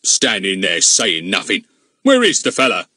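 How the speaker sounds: a touch of bullying; blustering scold. A narrator's reading. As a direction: policeman blaming the gardener. Goon scolds Larkin.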